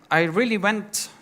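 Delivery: ordinary talk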